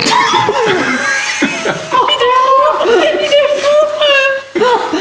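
long high shrieking laugh; uproarious laughter. Real, not acted. Friends reacting to the Nutella joke, long shrieking laughter. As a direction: burst out laughing, high and helpless.

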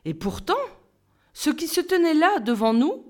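ordinary delivery, nothing marked

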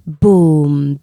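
stomp it out like a giant's step; booming footsteps. A narrator's reading.

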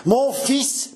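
high-pitched, incredulous exclamation; old father reeling in disbelief; aghast old man. A narrator's reading. Old man answering the lover who asks to be his son, aghast stammer. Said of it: "balbutia le vieillard".

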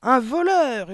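very loud, high yell; furious headmistress scream; yelled accusation. A narrator's reading. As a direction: howl the accusation in fury. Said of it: "hurla mademoiselle Le Gourdin".

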